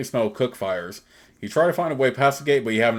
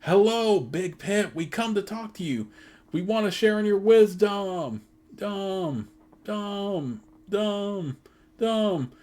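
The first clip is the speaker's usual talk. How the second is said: high calling chant to the pit; high, ringing call into a chasm; crying out to the pit; 9 semitones higher, slower than usual. Acted.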